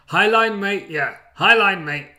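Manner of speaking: loud Aussie coach bark; loud mocking Aussie manager chant. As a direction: shout it like a cocky Aussie manager. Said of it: "do the same".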